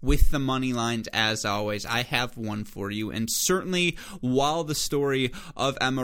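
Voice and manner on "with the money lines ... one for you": usual speaking voice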